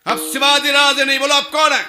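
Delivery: high, very loud demand; shouted interrogation. A narrator's reading. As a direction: yell it at a captive to force an answer. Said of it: "ओरडला".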